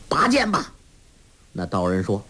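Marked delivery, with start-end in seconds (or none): 0.1-0.7 sharp high-pitched call to fight; shouted challenge. A narrator's reading. Yang Tiexin challenging the Taoist to draw his sword, high shout. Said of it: "杨铁心喝道".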